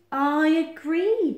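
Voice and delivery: loud, high, drawn-out agreement; overbearing Badger talking over someone; interrupting. A narrator's reading. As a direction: cut in forcefully, stretching both words. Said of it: "Badger interrupted".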